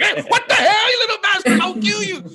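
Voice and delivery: very high-pitched startled yelling; mock terrified outburst. Startled parent at a kid staring in the night, mock panicked yell. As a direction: play a panicked, threatening freak-out. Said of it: "It's terrifying".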